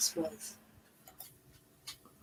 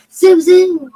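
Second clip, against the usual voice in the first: louder shouted name; loud call; 9 dB louder than usual. Retold or imitated. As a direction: holler her name across the house. Calling out, voicing friend.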